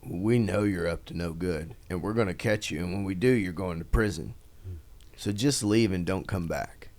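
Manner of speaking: low, soft mock-menacing warning; understated, low-voiced threat; quiet warning. Retelling a stern warning he received.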